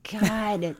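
ordinary delivery

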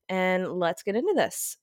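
normal talking voice

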